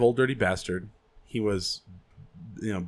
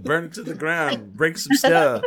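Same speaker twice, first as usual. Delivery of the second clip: mock riot call; 6 semitones higher, 3 dB louder than usual. Repeating the Willie bit.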